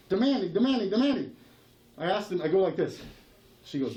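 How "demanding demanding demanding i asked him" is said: usual speaking voice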